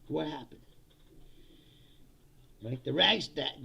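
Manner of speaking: normal talking voice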